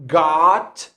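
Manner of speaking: "got" with open, unrounded lips, like "gaht"; American "got" with an ah vowel. Shown as a demonstration. Teacher showing learners the O sound, American "got". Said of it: "it's not rounded".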